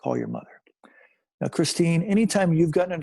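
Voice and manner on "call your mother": low-pitched voice from the corner; lightly voiced, low instruction from nowhere; mysterious voice. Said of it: "I listen to the voice one more time".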